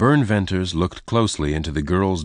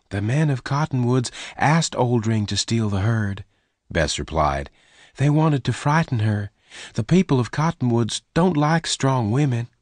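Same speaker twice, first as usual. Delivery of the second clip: put-on lightly raised, higher girl's voice; higher voice for the rustler's girl; young woman Bess; 5 semitones higher than usual. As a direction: voice Bess higher and gentle-toned.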